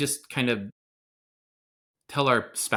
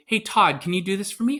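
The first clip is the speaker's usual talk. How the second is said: higher, acted-out asking voice; friendly request; 8 semitones higher, 3 dB louder than usual. Close friend asking a favour, lightly acted friendly request. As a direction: ask a favour in a high lilt. Acting out a connected person's request.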